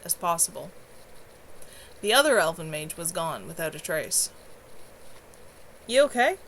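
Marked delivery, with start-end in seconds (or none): 5.8-6.4 hoarse. Acted. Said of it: "Kevin asked hoarsely".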